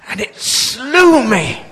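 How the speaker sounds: slow, loud West Country cry; old preacher gripping the table, West Country accent. Retold or imitated. Old preacher gripping the table, loud slow West Country accent. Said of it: "very strong country accent like that".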